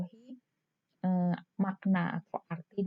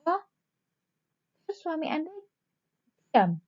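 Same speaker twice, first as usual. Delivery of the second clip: off-topic interruption; 8 semitones higher than usual. Shown as a demonstration. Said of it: "misalnya seperti itu".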